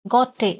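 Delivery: Gautier repeated as "go-tay"; French pronunciation. Shown as a demonstration. Teacher repeating the French name, Gautier as "go-tay". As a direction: say Gautier as two syllables, no L or R. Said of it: "as per the French pronunciation".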